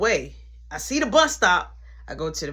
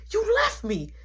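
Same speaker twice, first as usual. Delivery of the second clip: pitched way up, wounded; high hurt whine; 12 semitones higher than usual. Retold or imitated. To Jamie who drove off, high hurt whine. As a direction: squeal it like you were betrayed. Confronting girlfriend who left him.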